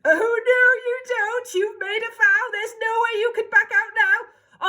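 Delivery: shrill ugly sister. Acted. Ugly sister scolding prince.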